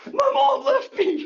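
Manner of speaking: very high, loud mock wail; hammed-up distraught wail. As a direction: play an abandoned kid squealing to police. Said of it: "Okay, calm down, son".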